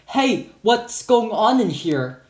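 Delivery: high, slow calling-out; acted shout. Security guard bursting into classroom, shouting. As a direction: call out slowly, high, demanding an answer. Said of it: "entered and shouted".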